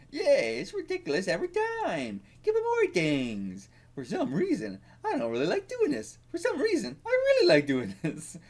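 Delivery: high-pitched manga beggar character; put-on beggar voice. Reading a beggar's line aloud from the manga, put-on high voice.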